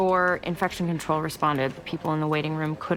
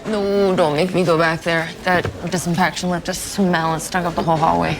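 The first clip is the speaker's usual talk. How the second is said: loud complaining; 7 dB louder than usual. Acted. Complaining to a colleague about the smell.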